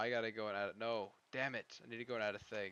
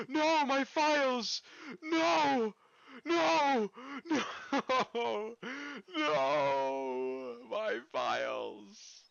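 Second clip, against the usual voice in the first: loud, high wailing No! over lost files; mock-wail like a tragedy struck, half laughing; hammed-up despair; 12 semitones higher, 9 dB louder, much slower than usual. Streamer loses his saved files.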